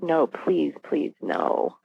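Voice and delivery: hushed, low retold plea; inward pleading. Mother silently begging it isn't true, hushed pleading. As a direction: plead under your breath, dropping away. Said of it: "inside my heart is beating like crazy".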